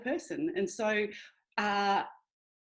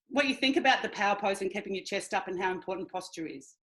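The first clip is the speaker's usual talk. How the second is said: normal talking voice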